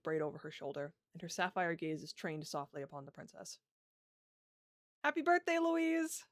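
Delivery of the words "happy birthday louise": excited. Acted. Knight greets princess at party.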